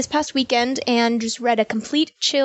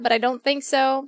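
ordinary talk

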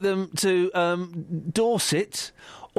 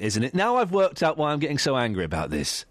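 ordinary talk

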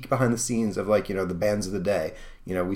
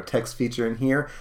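ordinary delivery

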